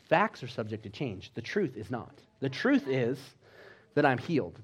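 ordinary talk, nothing marked

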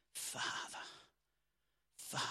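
breathy whisper, almost no voice; whispered Father. Acted. Preacher whispering the name to his church, hushed. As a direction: whisper it like a prayer.

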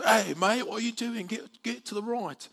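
high-pitched imitation London accent; put-on London accent. London commuter telling a tourist off on the escalator, mock London accent. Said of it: "I don't know why I went into accent".

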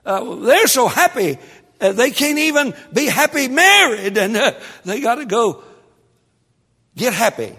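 high, loud impassioned preaching; preaching shout. Acted. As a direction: cry it out to the congregation.

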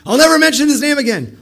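high, loud outburst; acting weird. Jeremiah swearing off God's name, erratic high shout. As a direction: shout it like a wild overreaction. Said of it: "See how he's acting weird?".

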